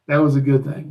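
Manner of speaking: ordinary talk, nothing marked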